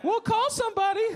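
very high mock-helpful call; passersby calling out. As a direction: toss it out in a high bystander voice. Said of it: "drive away and be like".

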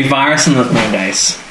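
ordinary talk, nothing marked

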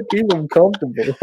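real laughter through the words, pitched high; laughing. Real, not acted. Cracking up at the co-host's running joke, laughing. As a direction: say it while cracking up.

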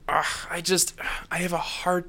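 breathy whispered ugh of frustration; exasperated whisper. Real, not acted. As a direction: sigh it out, fed up. Exasperated sigh of disbelief.

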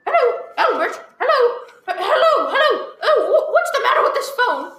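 desperately trying to reach someone; frantic hellos. Acted. Invalid wife calling into a silent phone, high and panicky. Frantic caller on dead line.

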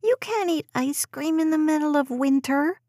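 put-on high child's voice begging to stay; a preschooler pleading to stay upstairs; pleading little girl. A narrator's reading. Daughter arguing with mom.

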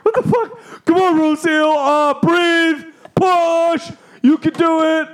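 high-pitched, loud birth coaching; hammed-up panicked husband cheering her on; imitated frantic yelling. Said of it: "You yell at her".

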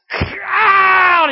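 high, loud anguished yell; pained scream. Acted. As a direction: scream out in pain. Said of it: "he screams out".

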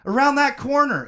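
high, loud urgent shout; cried. A narrator's reading. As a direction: yell it over the noise. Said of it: "Anakin cried".

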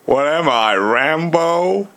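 slow Southern American drawl rising at the end; Forrest Gump. Pretend. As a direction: put on a simple-minded Southern American accent. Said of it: "I can only think of Forrest Gump".

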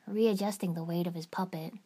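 usual speaking voice

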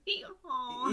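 high, loud hee-haw bray; donkey bray. Retold or imitated. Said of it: "which is when a donkey goes".

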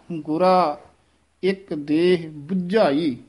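ਗੁਰਾ clean without a nasal, slow ਇੱਕ ਦੇਹ ਬੁਝਾਈ; ਗੁਰਾ plain, then slow; ਗੁਰਾ, no bindi sound. Shown as a demonstration. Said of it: "ਗੁਰਾ ਪਦ ਤੇ ਬਿੰਦੀ ਨਹੀਂ ਲਾਉਣੀ ਹੈ".

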